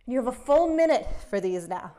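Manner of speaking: ordinary delivery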